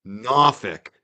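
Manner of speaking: NORFOLK as "NAH-fick", South Shore style; South Shore accent Norfolk. Shown as a demonstration. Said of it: "Is that how they say it?".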